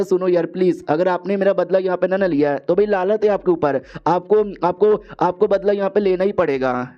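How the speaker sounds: plain reading voice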